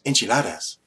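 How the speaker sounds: put-on enchiladas with a showy Spanish accent; exaggerated Spanish accent. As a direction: newsreader suddenly switching into Spanish pronunciation on enchiladas. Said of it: "they would put that accent on".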